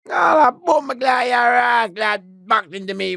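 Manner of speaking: high, slow, shouting jeer; mocking shouted taunt. Inmate jeering at McGlade after bumping him, loud mock shout. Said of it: "he said".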